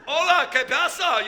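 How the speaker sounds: loud, high mock Mexican greeting with a laugh; Mexican donkey. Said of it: "He wasn't a Mexican donkey".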